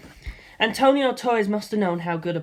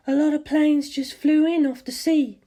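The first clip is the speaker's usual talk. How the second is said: higher, lightly voiced worried mother; 9 semitones higher than usual. A narrator's reading. Narrator voices his mother on the phone, worried. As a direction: play the mother, a little alarmed.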